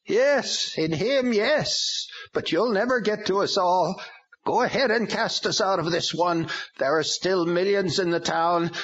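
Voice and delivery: high, strange demon voice, gloating; taunting demon. A narrator's reading.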